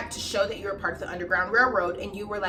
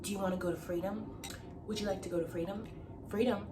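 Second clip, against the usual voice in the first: hushed, quick teasing offer; soft coaxing; 2 semitones lower, 13 dB quieter, faster than usual. Retold or imitated. Teacher quietly tempting the kids toward freedom, hushed. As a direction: lure them softly in the dark. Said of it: "starts asking us".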